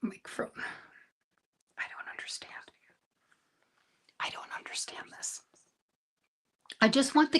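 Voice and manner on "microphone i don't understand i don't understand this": a hushed, helpless complaint to yourself; giving up in a whisper; exasperated whisper. Real, not acted. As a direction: whisper it under your breath, defeated. Stuck on the settings.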